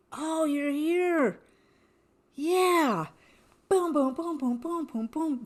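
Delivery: high, excited little voices greeting her with a beat; tiny herbs' voices. Retold or imitated. Microbes in the ocean welcome her with high excited chatter. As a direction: squeak it out like happy tiny microbes. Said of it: "just like".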